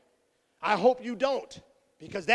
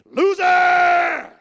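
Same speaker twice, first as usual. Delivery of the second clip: much higher, loud taunting yell of "Loser!"; mocking shout; 9 semitones higher, 12 dB louder than usual. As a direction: bellow it to crush a kid. Mocking his son.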